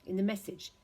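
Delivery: ordinary delivery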